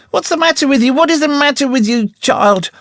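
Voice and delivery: high, frightened cry; a scared aunt at the bedside; gasped out. A narrator's reading. Aunt Polly at Tom's bedside, frightened high cry. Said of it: "She gasped out".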